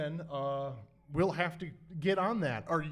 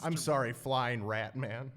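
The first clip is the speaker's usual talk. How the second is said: softer, slower and lower; gentle; 5 semitones lower, 6 dB quieter, slower than usual. Acted. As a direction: coax him kindly. Commissioner gently offers Snickers.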